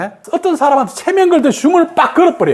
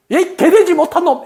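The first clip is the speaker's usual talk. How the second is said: higher-pitched mock parental insult; strong insult; 7 semitones higher than usual. Teacher imitates a parent cursing a child, mock scolding. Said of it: "강하게 뭐예요".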